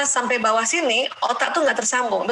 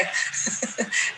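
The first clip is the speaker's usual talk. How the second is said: soft breathy laugh; 6 dB quieter than usual. Real, not acted. Laughs after joke about husband.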